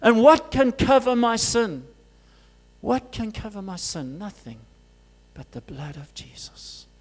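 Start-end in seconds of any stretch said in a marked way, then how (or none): 2.8-6.9 soft whispered answer; hushed whisper. Acted. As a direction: drop to a whisper. Dropping to whisper.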